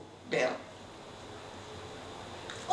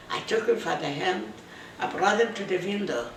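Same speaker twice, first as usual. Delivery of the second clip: ordinary delivery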